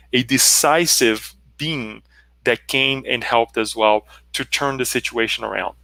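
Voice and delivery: ordinary talk, nothing marked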